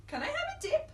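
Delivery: high squeaky little voice; squeaky turtle voice. Acted. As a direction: ask in a tiny high voice. Turtle asks.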